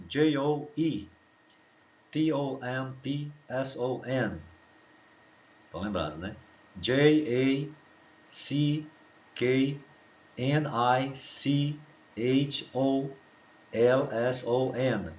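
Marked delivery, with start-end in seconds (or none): 0.1-4.4 slow letter-by-letter spelling. Shown as a demonstration. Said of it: "Então vamos ver aqui o Joe Thompson". 6.8-15.1 slow letter-by-letter spelling. Shown as a demonstration. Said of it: "vamos ver aqui".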